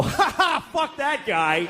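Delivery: high mocking laugh, then a shrug; hippie laughing. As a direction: cackle it off dismissively. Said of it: "He'll go".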